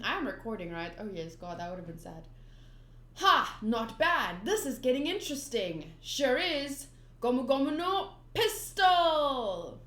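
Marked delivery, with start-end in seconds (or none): 3.2-9.8 loud confident battle banter; cocky fighters. Acted. Luffy calling his attack, loud and cocky. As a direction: call out the attack proudly.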